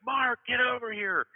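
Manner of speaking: high, loud call, slowed and drawn out; calling across a room. Retold or imitated. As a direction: call him over from a hundred feet away. Manager calling from far away.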